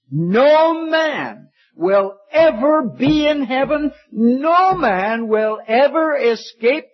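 high, slow, voice near tears; tearful preaching. Real, not acted. Preacher warning the congregation, slow and tearful. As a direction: plead it, almost crying.

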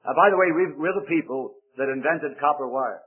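ordinary delivery

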